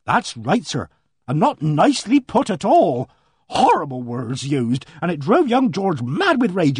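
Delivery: a flustered countrywoman pouring out her news; voiced housekeeper. Mrs Baker retells quarrel.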